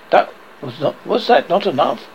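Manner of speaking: plain reading voice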